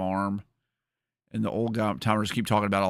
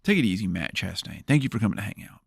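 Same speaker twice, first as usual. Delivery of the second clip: ordinary talk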